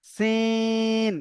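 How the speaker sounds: সিন with a whistle-like s and a very long vowel; সিন held for three alif beats; whistling Sin. Shown as a demonstration. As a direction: stretch সিন slowly, hissing the s. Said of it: "সেটির মতো উচ্চারণ হবে, তিন আলিফ টান".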